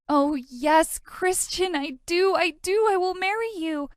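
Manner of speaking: high, breathless gushing yes; dreamy swoon. Acted. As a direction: swoon over the proposal. Dreamy acceptance of proposal.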